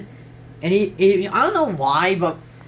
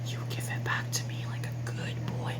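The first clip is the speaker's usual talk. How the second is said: whisper; 13 dB quieter than usual. Acted. Teasing quote about the pen.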